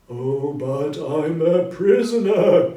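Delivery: very loud, slow anguished cry; phantom's cry. Acted. Marley's ghost lamenting his prison, loud slow cry. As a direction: wail out your chains. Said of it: "cried the phantom".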